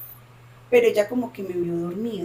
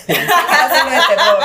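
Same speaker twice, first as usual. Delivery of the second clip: laughing; 6 semitones higher, 12 dB louder than usual. Real, not acted. Laughs at her own story.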